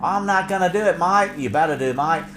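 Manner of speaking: hammed-up Australian soap characters; Australian accent. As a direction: act it out in broad Australian. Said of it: "they used to speak a lot more Australian".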